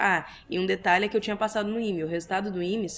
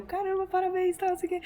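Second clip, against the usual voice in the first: friend's congratulations; 11 semitones higher than usual. Retold or imitated.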